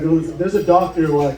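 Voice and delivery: ordinary talk, nothing marked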